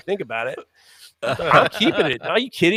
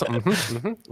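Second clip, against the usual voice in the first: low, drawn-out judging hum; judgment noise; 4 semitones lower, 7 dB quieter, slower than usual. Shown as a demonstration. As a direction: hum like a therapist taking notes. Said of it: "That's my judgment noise".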